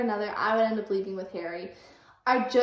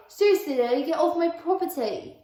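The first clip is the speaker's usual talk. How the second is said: angry order; 5 semitones higher, 4 dB louder than usual. Acted. Harry throwing Lily out again.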